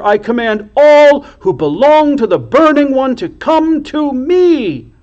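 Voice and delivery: high, loud, shrill proclamation; piercing high commanding cry. A narrator's reading. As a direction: shriek out the summons with authority. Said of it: "Her voice was shrill".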